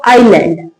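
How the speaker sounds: आइलैंड with no S, eye-land; silent S in island. Shown as a demonstration. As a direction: say आइलैंड, gliding from I straight to L. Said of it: "तो एस silent रहता है".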